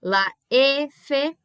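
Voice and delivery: "La F" as "la êfe"; Spanish letter name "F", narrow ê; closed E. Shown as a demonstration. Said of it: "Sempre fechado".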